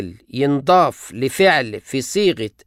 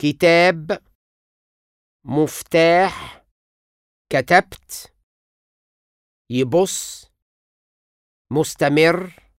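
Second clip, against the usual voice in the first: slow, deliberate example words; final closed syllable stress; slower than usual. Shown as a demonstration. Teacher showing final stress. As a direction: land the stress on the last syllable. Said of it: "وياخد النبر".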